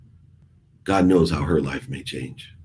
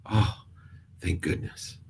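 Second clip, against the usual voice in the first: relieved whisper; 10 dB quieter, slower than usual. Real, not acted. Relief daughter is safe.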